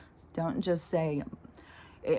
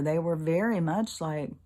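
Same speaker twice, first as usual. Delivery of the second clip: ordinary talk, nothing marked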